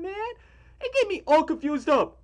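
ordinary talk, nothing marked

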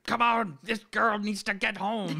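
loud, high-pitched mock yell; imitated yelling. Imitating a priest yelling at a bus driver, loud yell. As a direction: bellow an impatient order, in imitation. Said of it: "yells at the bus driver".

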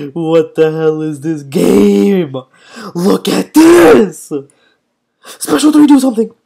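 loud shout climbing high; real frustrated gamer outburst; exasperated yelling. Real, not acted. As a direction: yell at the game, stretching words. Yells at the game.

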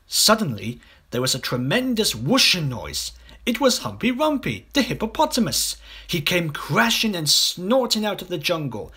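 ordinary delivery, nothing marked

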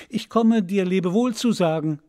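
the bird calls goodbye to the prince; calling out. Acted. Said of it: "rief er". (laut und höher zum Abschied rufend)

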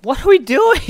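high, loud yell breaking into laughter; exasperated yell. Real, not acted. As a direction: shout it in helpless frustration. Exasperated, lost in game.

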